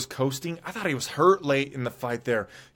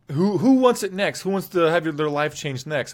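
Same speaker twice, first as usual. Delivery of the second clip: mock loud callout; 4 semitones higher, 4 dB louder than usual. Perry's post-fight callout quoted.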